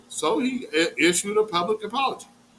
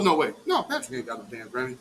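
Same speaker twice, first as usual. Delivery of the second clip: usual speaking voice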